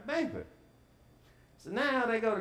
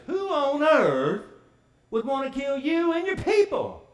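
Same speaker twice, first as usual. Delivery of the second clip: outraged king; 6 semitones higher, 6 dB louder than usual. Retold or imitated. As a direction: stretch each word out like a furious king.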